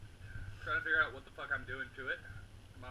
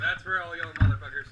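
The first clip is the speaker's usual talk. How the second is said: loud shout; 12 dB louder than usual. Real, not acted. Sign-off after losing challenge.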